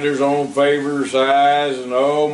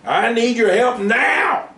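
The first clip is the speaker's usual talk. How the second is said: straining mule; 11 semitones higher, 4 dB louder, slower than usual. A narrator's reading. Joe straining at plow.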